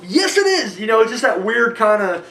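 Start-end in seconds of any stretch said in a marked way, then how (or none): 0.1-0.8 mock emphatic agreement. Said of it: "I'm like".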